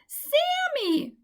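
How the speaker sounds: very high loud call; lost mouse calling for the squirrel, high loud; crying out. A narrator's reading. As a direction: shout into the trees for her friend. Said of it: "Michaela cried out".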